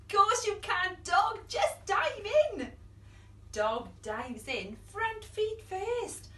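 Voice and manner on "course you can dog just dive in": excited welcome. Acted. Children welcoming Dog.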